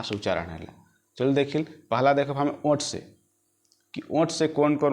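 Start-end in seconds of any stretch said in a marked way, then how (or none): none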